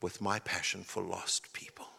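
hushed sincere sermon voice; quiet, earnest preaching. Acted. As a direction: draw them in close and gently. Quiet intimate sermon section.

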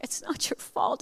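slow, whispered mock-tearful reassurance; choked, voiceless imitation of crying; imitated sobbing. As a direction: squeeze it out through pretend sobs. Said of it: "I'm sobbing like I can't".

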